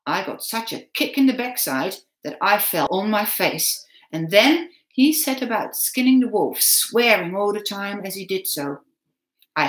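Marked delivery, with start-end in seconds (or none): none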